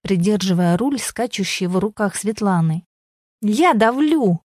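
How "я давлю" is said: high, strained yell of protest; mock yell at the driving instructor; yelling back. A narrator's reading. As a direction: shout it, sure she is pressing the right pedal. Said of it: "орала Светлана".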